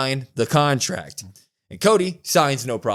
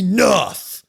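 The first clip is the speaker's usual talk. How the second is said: high, firm imitation of an angry dad; mock-stern dad outburst; pissed dad voice; 8 semitones higher, 4 dB louder than usual. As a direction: cut them off like a fed-up father. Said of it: "comes back with the dad voice, man. And he's pissed".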